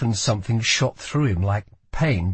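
ordinary delivery

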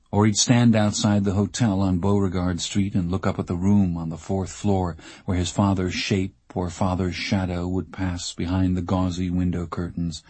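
ordinary delivery, nothing marked

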